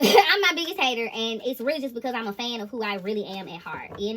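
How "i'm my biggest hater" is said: laughing repeat. Retold or imitated.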